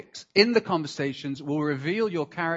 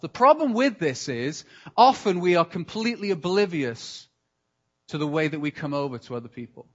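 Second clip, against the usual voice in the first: usual speaking voice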